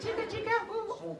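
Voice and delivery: very high, soft squeaky mouse chant; quiet mouse voice. Shown as a demonstration. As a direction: squeak it softly like a tiny mouse. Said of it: "say it like a mouse now".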